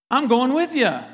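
keen fisherman signing up; eager agreement. Acted. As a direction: jump in with gusto, count me in. Disciples eagerly join Peter.

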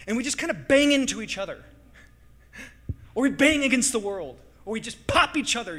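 loud, high, fired-up preaching; preaching at full force. Acted. Pastor to congregation, loud fired-up preaching. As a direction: whip up the room.

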